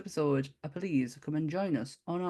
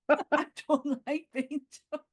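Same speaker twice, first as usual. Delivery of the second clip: ordinary talk, nothing marked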